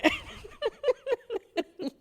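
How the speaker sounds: high, breathy giggle at herself; burst of laughter. Real, not acted. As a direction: laugh it off, embarrassed at her tears.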